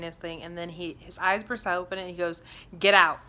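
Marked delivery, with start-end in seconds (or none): 2.8-3.2 loud, sudden retold command; sharp command. Medium snapping his eyes open at her, loud command. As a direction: bark it like a sudden warning.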